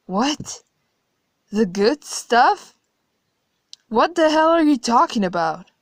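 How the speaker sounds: high, loud, shocked outburst; outraged disbelief. Acted. Narrator horrified that God enjoys atrocities, loud outrage.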